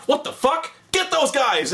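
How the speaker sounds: high-pitched mock outrage; startled commander rallying his men, hammed up; Washington. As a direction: pitch it up into an alarmed order. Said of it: "Washington sees this, he's like".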